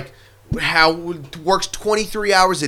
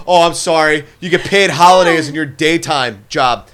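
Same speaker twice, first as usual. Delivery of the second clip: loud, higher hammed-up sneer; hammed-up sarcastic pity; sarcastic mock pity; 3 semitones higher, 7 dB louder than usual. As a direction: sneer at the spoiled complainer, loud and high.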